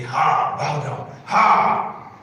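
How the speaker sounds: loud, explosive "ha" and order; prophet's command. Acted. Preacher mimics a prophet commanding people at the door, loud command.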